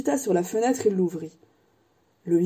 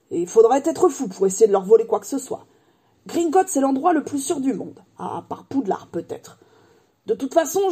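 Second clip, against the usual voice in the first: raised, hearty giant voice, proud; proud giant Hagrid; 5 semitones higher than usual. A narrator's reading. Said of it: "assura Hagrid avec fierté".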